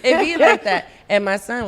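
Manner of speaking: ordinary delivery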